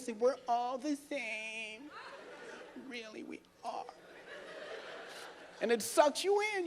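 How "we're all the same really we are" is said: softer, slow, mock sincere; heartfelt. Comic showing how to sell the line, soft mock sincerity. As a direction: say it like you truly mean it. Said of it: "you put your heart into it".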